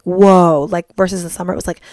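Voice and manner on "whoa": amazed whoa. Retold or imitated. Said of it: "and I'm like".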